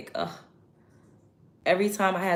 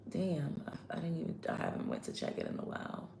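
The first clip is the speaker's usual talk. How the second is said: muttered to self; 13 dB quieter than usual. Real, not acted. Realizes she forgot, muttering.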